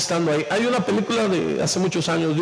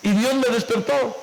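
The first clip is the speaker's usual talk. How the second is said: normal talking voice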